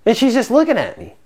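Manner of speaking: ordinary talk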